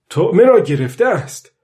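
a fisherman's thrill at a big catch; excited old fisherman. Acted. Old man feeling the fish take the bait, excited.

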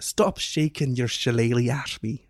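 put-on slow, careful Irish accent. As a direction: draw it out in an Irish brogue. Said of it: "I don't know if I could do that".

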